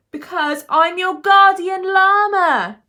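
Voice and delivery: high, full-force, drawn-out shout. A narrator's reading. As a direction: bellow the confession high and slow, losing patience. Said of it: "Levi shouted".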